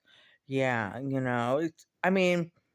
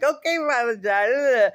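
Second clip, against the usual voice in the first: high, louder bouncy reply; bouncy, lighthearted; 8 semitones higher, 5 dB louder than usual. Retold or imitated. Answering serious Father John, bouncy and lighthearted. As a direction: chirp it back playfully. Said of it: "all bouncy and".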